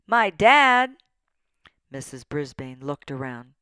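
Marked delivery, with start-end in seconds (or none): 0.1-1.0 loud, high schoolboy shout. A narrator's reading. Pupil blurting an answer in class, shouted out. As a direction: call it out sharply across a classroom. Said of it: "A voice shouted out".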